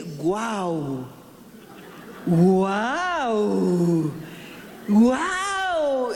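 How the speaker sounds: low then rising playful woofs; happy woof. Acted. Preacher voicing a happy dog barking at its mirror friends. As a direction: woof with joy like a glad dog. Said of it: "estaba tan contento que dice".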